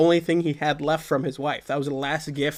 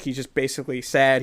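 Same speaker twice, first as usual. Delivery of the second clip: normal talking voice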